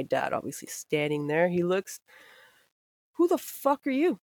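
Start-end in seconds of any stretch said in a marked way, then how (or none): 3.2-4.2 high-pitched furious demand, like a dad catching intruders; angry dad yelling. Retold or imitated. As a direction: bark it at the stranger, voice jumping up. Dad confronts strangers in house.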